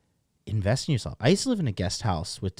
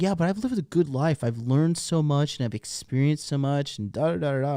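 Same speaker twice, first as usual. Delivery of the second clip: higher, lightly hammed-up voice of a cheerful old man; mock upbeat old man; 5 semitones higher than usual. Vlogger mimics a lonely old man bragging, in a lightly hammed-up upbeat voice. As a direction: play him proud of his life, a little over the top. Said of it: "He's like".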